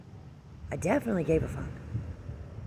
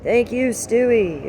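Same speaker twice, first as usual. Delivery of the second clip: sarcastic thanks; 7 semitones higher, 8 dB louder, much slower than usual. Real, not acted. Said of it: "sarcastic thanks".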